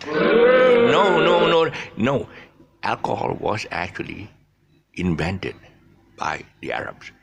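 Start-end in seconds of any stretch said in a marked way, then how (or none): 0.1-2.3 loud, high string of rebuttals, easing down; emphatic denial. Real, not acted. Teacher rebutting doubters about who invented alcohol, emphatic denial. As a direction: shout the first no, then settle.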